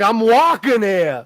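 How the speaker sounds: loud, drawn-out mock New York bark; New York accent impression. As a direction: imitate an indignant New Yorker, loud and slow. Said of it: "you're over here like".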